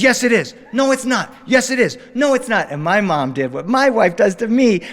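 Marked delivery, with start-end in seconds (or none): 0.0-0.5 loud insistent dad; dad arguing with his son, louder; mock argument. 0.7-1.3 put-on high shouting kid; boy shouting back at his father; childish shout. Father and son arguing. 1.5-2.0 loud insistent dad; dad arguing with his son, louder; mock argument. 2.1-2.7 put-on high shouting kid; boy shouting back at his father; childish shout. Father and son arguing.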